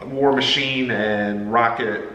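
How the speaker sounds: ordinary talk, nothing marked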